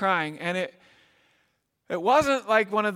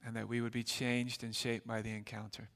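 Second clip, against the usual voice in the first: soft, hushed closing prayer; 6 semitones lower, 9 dB quieter than usual. Acted. Pastor closing a prayer before the sermon, softly. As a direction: pray quietly over the congregation.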